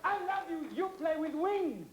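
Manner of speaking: put-on Italian accent. Pizza man meeting his idol, gushing Italian accent. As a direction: adore them in a hammed-up Italian accent. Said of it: "stop using that ridiculous Italian accent".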